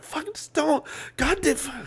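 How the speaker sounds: high strained fighting back tears; mock frustrated plea. Streamer recalling fighting tears on stream, high strained plea. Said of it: "and I'm like".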